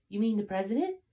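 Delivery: high, disbelieving question; incredulous. Acted. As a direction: assassin doubting Gray, incredulous. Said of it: "asked the assassin incredulously".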